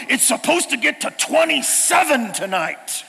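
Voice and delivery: a hint of panic in the friend's voice; friend freaking out. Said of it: "He's freaking out".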